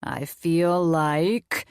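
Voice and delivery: very slow, drawn-out silly monster voice; funny voice. Acted. Colin playing mad scientist to the kids in a funny voice. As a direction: stretch it out like a cartoon mad scientist. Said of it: "Colin said in a funny voice".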